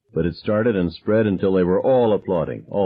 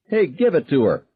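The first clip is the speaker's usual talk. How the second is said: high egging-on yell; 9 semitones higher than usual. A narrator's reading. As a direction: cheer the fight on. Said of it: "Billy Sawyer yelled".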